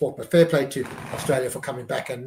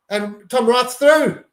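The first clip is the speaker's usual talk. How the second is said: higher, drawn-out call as the winger breaks; alarmed excitement; 7 semitones higher, 6 dB louder, slower than usual. Real, not acted. Fan watching the other side break through, slow and alarmed. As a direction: stretch the words as the danger opens. Opposing wing breaks the line.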